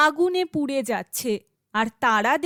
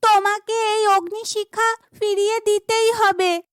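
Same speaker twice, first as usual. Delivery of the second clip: much higher, louder demanding; defiant demand; 8 semitones higher, 3 dB louder than usual. Acted. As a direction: stand up to the villain. Heroine demands return defiantly.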